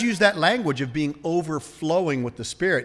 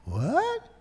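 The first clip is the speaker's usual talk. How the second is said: high, rising question; incredulous; 11 semitones higher, 4 dB quieter than usual. Acted. Eve hearing the devil's claim, high incredulous question. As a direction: squeak it out in mock surprise.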